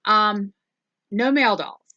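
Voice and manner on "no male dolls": ordinary talk, nothing marked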